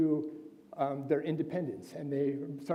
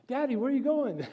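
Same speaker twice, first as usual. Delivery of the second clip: put-on high, eager child asking daddy; little girl voice; 8 semitones higher, 4 dB louder than usual. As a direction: imitate a small daughter calling out. Daughter voiced third time.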